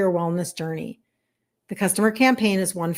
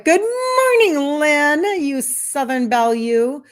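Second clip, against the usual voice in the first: high, slow, sung-out good morning; singsong greeting; 6 semitones higher, 5 dB louder, slower than usual. Real, not acted. Greeting viewer Lynn on the live, high singsong. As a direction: stretch the greeting up like a little tune.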